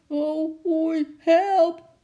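put-on high, loud panicked cry of a girl; shrill terrified child's scream; frightened shriek. A narrator's reading. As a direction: squeal for help in sheer fright. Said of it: "een hevig en verschrikt gegil".

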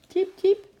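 high, loud squeaky chirp; cheerful bird chirp. A narrator's reading. Eki the bird chirps cheerfully to Sjimp, high and loud. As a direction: chirp it out like a happy little bird. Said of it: "piepte Eki opgewekt".

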